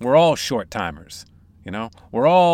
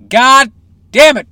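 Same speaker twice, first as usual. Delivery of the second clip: mock exasperated shout; 10 semitones higher, 14 dB louder than usual. Host working himself up about podcast.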